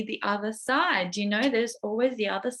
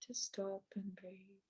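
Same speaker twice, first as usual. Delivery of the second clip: hushed reflection; 9 dB quieter than usual. Real, not acted. Reflecting after the crisis.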